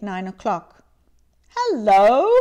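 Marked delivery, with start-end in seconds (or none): 1.6-2.3 loud feigned gruff bark; a hint of a put-on stern master; mock growl. A narrator's reading. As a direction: pretend to be the old grumpy Scrooge. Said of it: "growled Scrooge, in his accustomed voice, as near as he could feign it".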